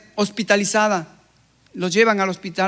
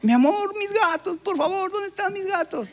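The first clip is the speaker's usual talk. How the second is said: much higher, pleading and fading; worried wife; 10 semitones higher than usual. Acted. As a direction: plead for the cats, voice rising high then softening. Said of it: "la mujer preocupada". (esposa preocupada suplicando por sus gatos, muy aguda y cada vez más suave)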